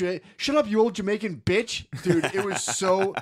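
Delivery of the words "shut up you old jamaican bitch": loud, high mock tantrum; imitated yelling. Kid insulting an old Jamaican man, imitated yelling. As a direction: yell it like a kid losing it. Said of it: "Joshua was yelling at the fucking".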